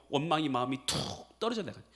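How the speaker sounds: usual speaking voice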